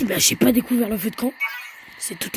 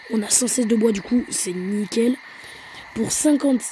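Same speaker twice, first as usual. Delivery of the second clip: ordinary delivery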